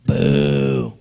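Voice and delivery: long, low, loud boo; deep, sustained mock jeer; crowd booing. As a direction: imitate a disapproving crowd with a deep "boooo". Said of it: "They were like".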